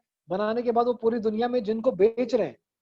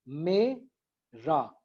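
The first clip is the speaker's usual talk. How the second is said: very slow मे ... रा with a clear gap; syllable by syllable; 3 semitones lower, much slower than usual. Shown as a demonstration. Teacher showing two syllables to a class, syllable by syllable. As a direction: break मेरा into two separate beats. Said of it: "ये दो syllable हो गए".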